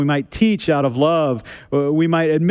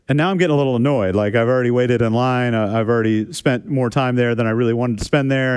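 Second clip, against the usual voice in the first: normal talking voice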